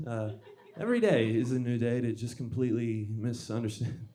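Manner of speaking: ordinary talk, nothing marked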